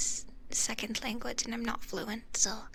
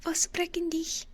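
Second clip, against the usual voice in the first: playful high German; 6 semitones higher, slower than usual. Acted.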